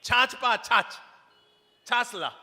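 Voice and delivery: loud, slow mocking call from the door. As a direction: yell across the house to the sister-in-law. दरवाजे से आवाज लगाई. (दरवाज़े से भाभी को ज़ोर से चिढ़ाती पुकार)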